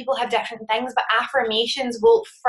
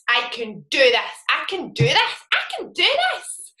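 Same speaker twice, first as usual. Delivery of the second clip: meaning it, building; 5 semitones higher than usual. Retold or imitated. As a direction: grow more convinced with each repeat, pitch soaring at the end. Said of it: "Say it like you mean it".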